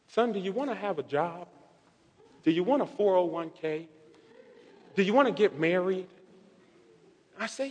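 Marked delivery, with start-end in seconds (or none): 0.1-6.1 soft motherly questioning; hushed, patient questions; gentle retold mother. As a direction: imitate Mom asking quietly, one question at a time. Retells mother questioning him gently.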